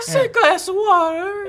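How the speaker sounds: very high, slow, strained German; wailing mock German accent; attempted German accent. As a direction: squeal it in a badly faked German accent. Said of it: "now I'm gonna do German".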